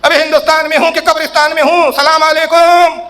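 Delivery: high, slow drawn-out salaam; mock the cleric's showy greeting; mocking pompous cleric. Preacher mocking a cleric's showy salaam, high and drawn-out. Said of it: "ارے مولویوں کیا جہالت کر رہے ہو یار".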